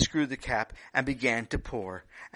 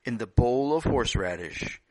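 storytelling narration; 8 dB louder than usual. A narrator's reading.

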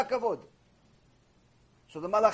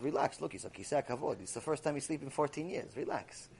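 low, much softer calming voice; soothing angel; 7 semitones lower, 12 dB quieter than usual. Acted. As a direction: settle the other angel down gently.

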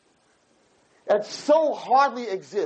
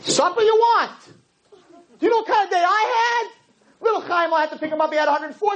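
much higher, exasperated wife voice; tired mother topping her husband's complaints, much higher; indignant wife; 9 semitones higher than usual. Retold or imitated. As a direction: fire back that her day was worse. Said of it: "what does she say back to him?".

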